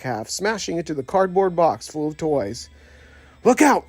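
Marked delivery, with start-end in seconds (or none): 3.4-3.8 higher, louder urgent shout; warning shout. A narrator's reading. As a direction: yell a warning to the others. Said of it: "Buzz shouted".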